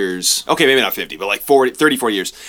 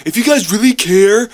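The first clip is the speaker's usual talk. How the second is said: high, louder, emphatic passerby voice; quoting passersby; 9 semitones higher, 4 dB louder than usual. Retold or imitated. Retelling what people at the protest said, emphatic and high. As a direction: bring the strangers' advice to life.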